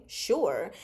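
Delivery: ordinary delivery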